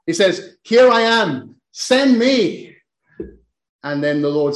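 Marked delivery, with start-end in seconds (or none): 0.6-2.8 higher and louder, an eager call; eager cry. Acted. Isaiah answering the Lord's call, higher and louder. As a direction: call out the offer to be sent, eagerly. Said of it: "Isaiah cries out immediately".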